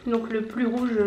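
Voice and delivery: ordinary talk, nothing marked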